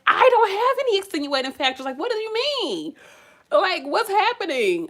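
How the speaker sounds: high, loud incredulous outburst; baffled disbelief. Retold or imitated. To the advisor, retelling her baffled reply, incredulous and high. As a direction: throw the question back in disbelief.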